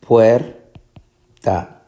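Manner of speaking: puerta broken as puer, pause, ta; syllable by syllable. Shown as a demonstration. Teacher dividing puerta for students, syllable by syllable. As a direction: leave a long gap between puer and ta.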